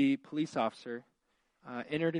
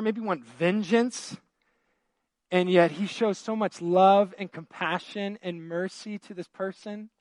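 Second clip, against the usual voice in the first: rising, louder, fervent preacher voice; preaching at full force; 4 semitones higher, 6 dB louder than usual. Acted. Pastor to congregation, about forgiveness, preaching with rising fervor. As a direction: preach it out, building passion.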